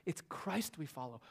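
normal talking voice

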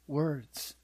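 hushed and slow, dropping away; soft. Shown as a demonstration. Preacher describes Jesus speaking to his enemy, hushed and slow. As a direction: let the words sink gently into quiet.

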